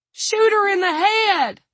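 high, loud, drawn-out scream; screamed plea. A narrator's reading. Panicked guard urges gunman to finish attacker, screaming. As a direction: scream it slowly in full panic. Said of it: "Dawn screamed".

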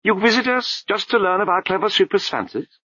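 a high babbling abbot voice, breaking into nonsense; abbot's voice. A narrator's reading. The abbot scolds the crowd, high babbling voice.

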